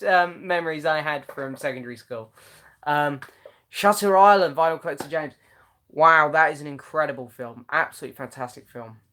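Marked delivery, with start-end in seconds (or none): none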